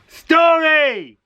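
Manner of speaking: big high call of the story title; shout. Shown as a demonstration. Storyteller leading a shout with the children, loud. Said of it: "One more go".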